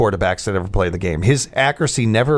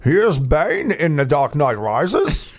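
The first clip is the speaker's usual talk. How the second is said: slow, put-on movie villain voice; Bane; 3 semitones higher, slower than usual. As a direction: draw it out in a mock villain voice. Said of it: "He does the voice of Bane".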